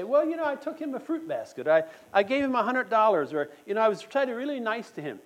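a lightly hammed-up excuse-maker saying "I did enough"; mock excuse voice. Mocks excuses of would-be penitents.

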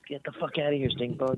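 high, sharp shoo at a bug. Real, not acted. Shooing a bug away.

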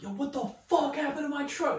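high, shocked angry father; a dad yelling at his son about his wrecked truck. Retold or imitated. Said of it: "So my dad's like".

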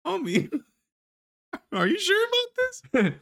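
high, doubtful voice with a laugh; mock hesitant. Cowboy asks his homie before being tied up, mock hesitant.

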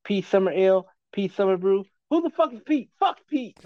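high, ranting put-on comic voice; hammed-up Leary impression, ranting at beer names; a comic ranting about a beer brand, high mock rant; Dennis Leary rant. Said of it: "Dennis Leary was like".

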